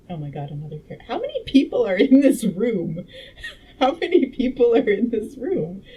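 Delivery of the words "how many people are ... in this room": loud, high incredulous outburst with laughter; laughing disbelief. Real, not acted. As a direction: exclaim it in amused exasperation. Exasperated at the number of characters.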